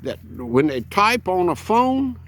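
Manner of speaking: put-on old hillbilly voice. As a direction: play a clueless old hillbilly. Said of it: "old man hillbilly voice".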